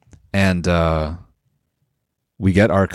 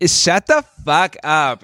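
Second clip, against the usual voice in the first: dismissive; 6 semitones higher, much slower than usual. Retold or imitated. Retorting to admirers.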